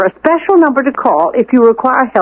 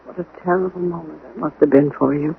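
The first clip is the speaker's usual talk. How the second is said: much softer and lower, gently consoling; hushed condolence; soft sympathy; 5 semitones lower, 9 dB quieter than usual. Acted. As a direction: ease off and comfort him quietly. Sympathy for identifying body.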